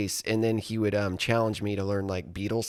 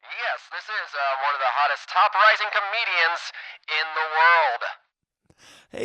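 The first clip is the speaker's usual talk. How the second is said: higher put-on voice of a boastful comedian on the phone; Tony Hinchcliffe; 6 semitones higher, 4 dB louder than usual. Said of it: "is this my buddy Tony Hinchcliffe?".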